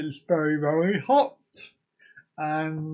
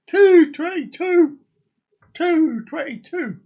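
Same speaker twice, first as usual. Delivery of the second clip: higher, louder, slower, a mock cry of triumph; excited exclamation; 9 semitones higher, 5 dB louder, slower than usual. Streamer announcing the clock time, excited exclamation. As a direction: announce the time like big news.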